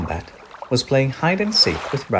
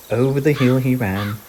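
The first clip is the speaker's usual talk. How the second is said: ordinary delivery, nothing marked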